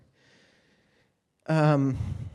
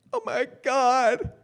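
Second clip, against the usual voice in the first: mock wail; 5 dB louder, slower than usual. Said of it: "And I'm like".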